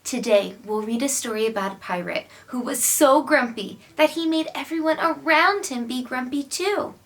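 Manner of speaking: plain reading voice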